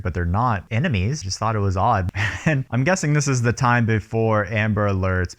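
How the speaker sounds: usual speaking voice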